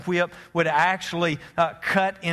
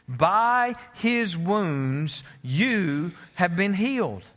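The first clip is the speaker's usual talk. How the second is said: slow solemn reading; slower than usual. Acted.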